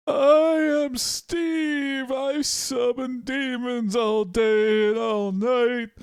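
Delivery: high, drawn-out otherworldly Steve voice; sing-song ethereal creature; ethereal being. Acted. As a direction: float each word up high with long held vowels. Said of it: "Does he talk like this?".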